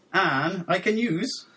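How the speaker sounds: "Anne, I can use" climbing high at the end; upward question inflection. Shown as a demonstration. Host reading out a question mark, "Anne, I can use" rising high. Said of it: "giving it an upward inflection".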